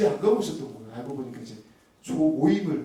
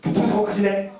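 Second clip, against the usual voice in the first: louder, higher exasperated snap; inner angry outburst; 5 semitones higher, 7 dB louder than usual. Retold or imitated. Husband fuming inwardly at wife's crash, louder snap. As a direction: blurt out the irritation. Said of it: "이런 마음이 제가 있었던".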